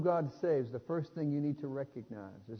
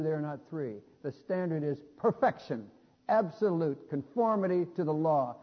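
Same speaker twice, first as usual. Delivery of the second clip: full-force preaching; 4 dB louder than usual. Acted. Preacher to congregation, declaring that no one reaches God, full-force preaching.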